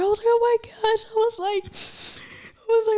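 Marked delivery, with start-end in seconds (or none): 0.3-1.0 high, panicky mock wail; retold freak-out. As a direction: re-enact a tearful panic. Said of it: "freaking out and everything".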